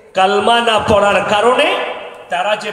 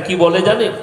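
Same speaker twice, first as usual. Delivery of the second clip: usual speaking voice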